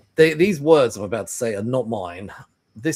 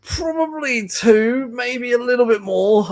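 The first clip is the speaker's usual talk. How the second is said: much higher, slow sheepish answer; retold sheepish answer; 13 semitones higher, 6 dB louder, slower than usual. Son retelling admitting the tiny cast to mum, high sheepish.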